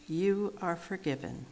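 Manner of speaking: usual speaking voice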